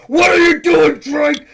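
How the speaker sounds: loud, high furious dad shout; angry dad voice. Retold or imitated. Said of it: "he's like".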